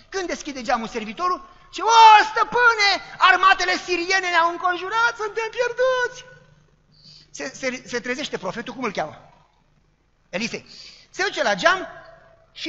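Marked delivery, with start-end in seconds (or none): 1.8-6.3 high, loud, slow alarmed wail; panicked servant cry. Acted. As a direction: cry out the army has surrounded us. Servant panics seeing army.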